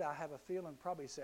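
ordinary talk

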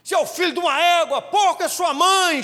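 loud, high furious yell; insult shouted back. Retold or imitated. Driver shouting back at the warner, loud furious insult. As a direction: scream the insult back at him.

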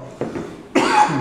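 sharp coughing fit; choking cough. Real, not acted. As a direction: cough hard on a drink. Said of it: "Engasguei com a água".

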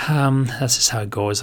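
ordinary talk

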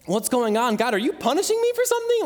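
retold bewildered plea to God; re-enacted desperate questioning, rising high; crying out. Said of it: "I'm crying out to God".

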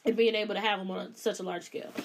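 ordinary talk

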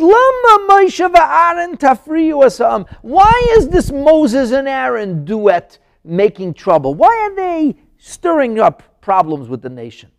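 loud, high, drawn-out demand; indignant Pharaoh. Acted. Pharaoh complaining about the troublemaking duet, loud and indignant. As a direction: complain forcefully, dragging out the key words. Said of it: "What did Pharaoh say?".

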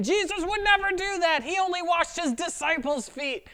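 high, scoffing imitation of outraged critics; a sneering self-righteous caricature; mock indignant critic. Angry critics quoted online.

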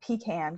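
pecan said as PEE-can; Southern PEE-can. Shown as a demonstration. Recalling how everybody back home said pecan, as PEE-can. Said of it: "'cause it's very Southern".

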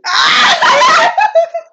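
very high loud laugh. Real, not acted. Sister laughing at her embarrassing memory, high loud laugh.

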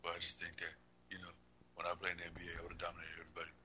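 quiet mumbled Shaq impression; barely audible. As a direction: talk way down, almost inaudible. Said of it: "I can barely hear you now".

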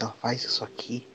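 confiding whisper. Shown as a demonstration. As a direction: drop it low like sharing a secret. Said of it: "Vou falar só entre a gente".

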